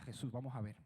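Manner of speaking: hushed, coaxing advice to Jesus; lightly voiced persuading disciple; cheeky advice to Jesus. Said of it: "le daría un consejo atrevido".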